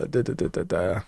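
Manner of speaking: soft whispered da da da; mumbling filler. Real, not acted. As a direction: murmur filler while searching. Humming while searching screen.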